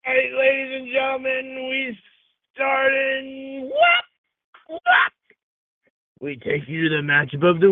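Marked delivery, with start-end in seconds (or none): 0.0-3.7 high, put-on announcer voice; announcer intro. 3.7-5.1 very high squealing cry; squealed what. Acted. Squealed exclamation.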